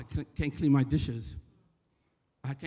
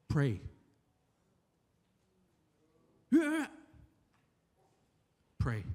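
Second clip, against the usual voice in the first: patient calming; 2 semitones lower, 4 dB quieter, slower than usual. Retold or imitated. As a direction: talk someone down slowly and patiently. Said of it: "by his patience".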